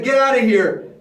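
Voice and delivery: loud, curt royal dismissal; stubborn order. Acted. Stubborn Pharaoh dismisses Moses, loud order. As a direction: throw them out as king.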